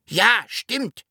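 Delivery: high small dragon voice; little dragon agreeing. A narrator's reading. Dragon finally understood by the Sams, high agreeing voice. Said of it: "sagte der blaue Drache".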